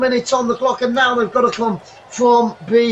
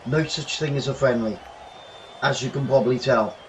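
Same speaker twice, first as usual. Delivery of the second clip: quiet aside; 10 semitones lower, 9 dB quieter than usual. Real, not acted. Quiet aside after rant.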